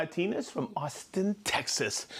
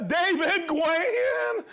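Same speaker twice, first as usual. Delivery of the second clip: Gwen drawn out slowly, much higher; drawn-out name; 11 semitones higher, 3 dB louder, much slower than usual. Shown as a demonstration. Calling out a viewer's name slowly, pitched high. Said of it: "Everybody say Gwen".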